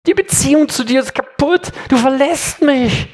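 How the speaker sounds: rising, pleading lament from the cross; anguished cry. Acted. Preacher voicing Jesus crying out on the cross, anguished. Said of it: "Er schreit am Kreuz".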